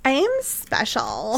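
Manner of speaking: slow, creepy ghost voice; eerie witch voice. Retold or imitated. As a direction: say it like a haunting spirit. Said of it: "I'm sure she didn't say it like a Valley Girl".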